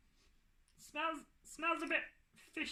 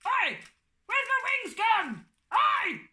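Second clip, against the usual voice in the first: loud, high angry outburst; 8 semitones higher, 7 dB louder than usual. Acted. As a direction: yell it furious and indignant. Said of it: "don't be angry".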